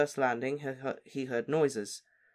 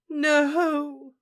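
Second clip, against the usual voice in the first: high, loud moan; a mother's anguished no; 14 semitones higher, 8 dB louder than usual. Acted. Mother moans at her husband's body, high and loud. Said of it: "Missus Weasley moaned".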